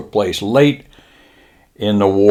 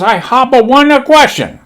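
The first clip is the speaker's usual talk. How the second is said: high, loud mock-Japanese recruit; Japanese lieutenant; 6 dB louder than usual. Lieutenant asking permission, high loud mock-Japanese voice. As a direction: announce it in a put-on Japanese accent. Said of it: "he says".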